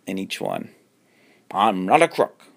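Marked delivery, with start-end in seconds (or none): 1.5-2.4 louder, odd alien voice through a mask; squeaky alien doing Nixon; high alien voice. A narrator's reading. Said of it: "said a high-pitched alien voice".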